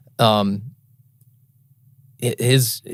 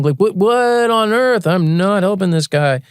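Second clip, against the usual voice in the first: higher, slow incredulous musing; baffled, as if asking heaven; exasperated disbelief; 8 semitones higher, 4 dB louder, slower than usual. Retold or imitated. Thinking while reading a gloomy psalm, mild disbelief. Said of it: "I'm thinking at this point".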